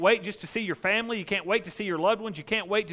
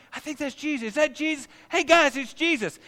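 excited calling; 10 semitones higher, 4 dB louder than usual. Retold or imitated. Crowd spotting Jesus.

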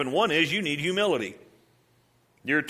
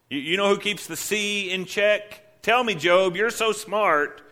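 slow, higher, mocking challenge; slow sarcastic taunt; sarcastic God; 6 semitones higher, slower than usual. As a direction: drag it out to needle Job. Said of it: "You remember his response to Job?".

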